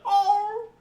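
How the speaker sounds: very high loud wail; loud high howl at the end; imitated howl ending. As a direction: finish with a rising ghostly oh. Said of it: "and then I heard the".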